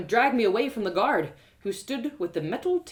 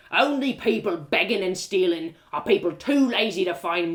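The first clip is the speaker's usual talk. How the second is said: gruff guard; 5 dB louder than usual. Acted. Castle guard lectures narrator about beggars, scornful. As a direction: sneer like a bossy castle guard.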